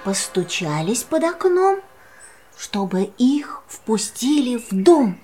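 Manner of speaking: ordinary delivery, nothing marked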